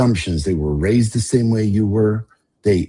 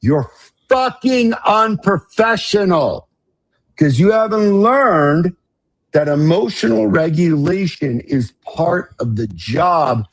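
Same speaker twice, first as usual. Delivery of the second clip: much higher, slow and pressing, every word hammered; heated slow rant; 9 semitones higher, slower than usual. Real, not acted. Podcast host scolding emotional teachers, raised and slow. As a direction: climb high and slow the rant right down.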